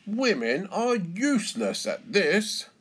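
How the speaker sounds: high, loud, swooping jeer; scornful taunt. A narrator's reading. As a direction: goad the challenger with smug contempt. Stallholder taunting the challenger.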